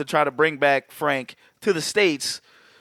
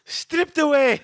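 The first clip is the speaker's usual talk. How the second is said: mock epic; 9 semitones higher than usual. Mock dramatic trailer line.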